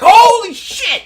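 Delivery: loud, high yell of alarm; panicked passenger yelling in shock, drawn out; shocked shout. Acted. Startled passengers voiced by game master.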